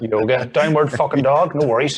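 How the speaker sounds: loud, barking Northern Irish take on yoga talk; aggressive Northern Irish. Pretend. Mocking how Northern Irish folk sound at Pilates, loud aggressive Northern Irish. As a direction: snap it out in a hard Northern Irish voice. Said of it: "we sound like fucking aggressive bastards".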